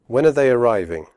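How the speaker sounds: fast, are reduced to schwa; quick natural question; quickly. Shown as a demonstration. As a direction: run it together quickly. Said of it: "first slowly and then quickly".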